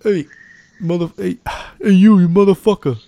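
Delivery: loud, high, hammed-up New York accent; badly faked New York street shout; attempted New York accent. Shown as a demonstration. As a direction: fumble into a brash New York holler. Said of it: "I can't do a New York accent right now".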